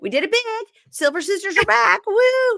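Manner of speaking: high-pitched gleeful whooping; hammed-up victory squeal; triumphant mock cheer. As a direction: crow it high, building to a loud woo. Acting out Stacy's triumphant boast.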